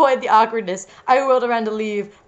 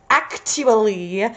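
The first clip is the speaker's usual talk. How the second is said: very slow, drawn-out "Actually"; character voice; much slower than usual. Acted. Reader voicing dark-haired band member, slow put-on voice. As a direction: stretch each syllable of "Actually". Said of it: "hang on, I gotta go".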